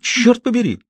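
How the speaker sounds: high sharp outburst; exclaiming. A narrator's reading. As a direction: fling the curse out high. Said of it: "выкрикнула Сюзанна".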